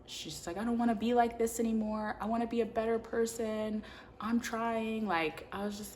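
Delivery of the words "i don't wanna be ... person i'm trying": higher, lightly plaintive retold confession; mock tearful. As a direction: imitate someone breaking down, without real sobs. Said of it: "breaks down and cries".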